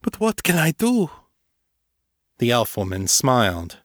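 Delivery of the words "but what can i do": high, pleading despair; lost and helpless. A narrator's reading. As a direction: sound utterly lost. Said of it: "Emilio whispered, sounding lost".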